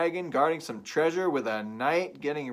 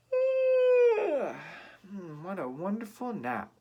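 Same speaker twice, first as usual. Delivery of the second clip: very high stretching yawn then sleepy voice; yawning Goldilocks; 9 semitones higher, 5 dB louder, slower than usual. Acted. Goldilocks waking in Baby Bear's bed, yawning. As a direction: wake up with a big yawn. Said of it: "stretched and yawned".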